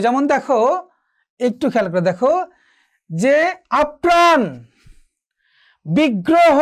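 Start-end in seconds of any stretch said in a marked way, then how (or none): 3.8-4.4 আপ্রাণ as ap-praan, high and emphatic; raised teacher's model of আপ্রাণ with a held p; doubled consonant. Shown as a demonstration. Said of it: "দ্বিত্ব হবে". 5.9-6.6 বিগ্রহ as big-gro-ho, higher pitch; raised teacher's model of বিগ্রহ with a held g; doubled consonant. Shown as a demonstration. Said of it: "দ্বিত্ব হবে".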